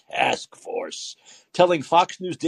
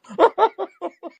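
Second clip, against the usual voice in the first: high burst of laughter. Real, not acted. Host cracks up at headline.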